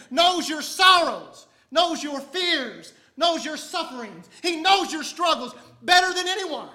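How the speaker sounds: loud, high, fervent sermon delivery; full-force preaching. Acted. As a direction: preach it out to the congregation with fire.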